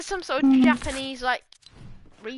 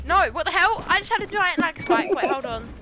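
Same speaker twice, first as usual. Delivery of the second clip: ordinary talk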